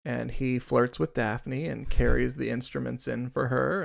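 ordinary delivery

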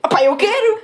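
put-on childish want it now; eager kid. Girl telling friend her childhood greed, eager.

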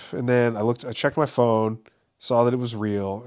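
ordinary delivery

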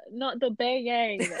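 ordinary talk

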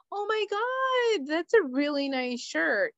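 high, insincere compliment; put-on over-the-top admiration; fake gushing. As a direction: gush with mock enthusiasm, starting way up. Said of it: "I'm not gonna be like".

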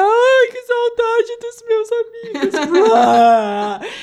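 high, slow teary wail; imitating her drunk sobbing over friends; mock tearful lament; tipsy crying. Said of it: "comecei a chorar".

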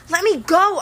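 high, loud demand. A narrator's reading. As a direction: insist they let him go. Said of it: "I demanded".

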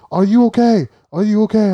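loud, high calls of are you okay; panicked rescuers shouting into a wrecked car; worried rescuers calling. Retold or imitated.

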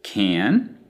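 can with a drawn-out a-as-in-cat vowel; extended American A. Shown as a demonstration. Teacher demonstrating the vowel extension, extended A. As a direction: hold and widen the A in can. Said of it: "has a very obvious extension of the vowel sound".

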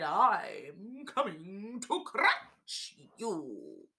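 slow, loud crocodile threat; menacing crocodile. Acted. Crocodile grinning at the paper dolls, slow and loud. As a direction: drag it out with gnashing menace. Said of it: "grinned his grin and gnashed his teeth".

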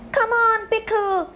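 high, loud call to spur a pony on; urging cheer. Acted. Princess to her pony mid-race, excited urging. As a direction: urge the pony forward excitedly.

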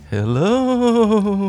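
high, loud drawn-out ghost wail; mock-haunting, stretch the vowel out high; spooky ghostly hello. Semi-friend greets listeners of a very spooky edition, ghostly.

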